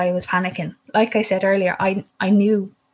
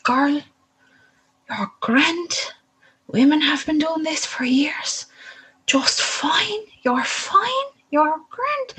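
rising ever higher, breezy and soothing; imitating the midwife; 8 semitones higher, slower than usual. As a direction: brush off her worry like a cheerful midwife. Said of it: "she's like".

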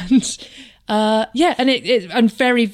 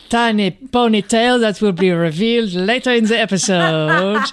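fake French accent. Mocking chaperone Claude to Lynn, hammy French accent. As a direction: ham up a bad Frenchman. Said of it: "Claude, the fake Frenchman".

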